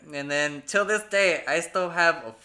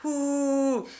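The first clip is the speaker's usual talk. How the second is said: tag downhill whoop; 8 semitones higher than usual. Retold or imitated. Retold thrill of speeding downhill.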